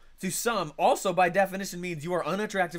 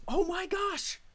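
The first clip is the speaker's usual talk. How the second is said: much higher sudden realisation; high gasp of discovery; imitated epiphany; much slower than usual. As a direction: voice the listener's aha moment. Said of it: "I need them to say to themselves".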